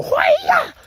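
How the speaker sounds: very high, loud martial-arts cry; kung fu shout. Shown as a demonstration. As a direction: yell it like a karate kick. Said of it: "you go".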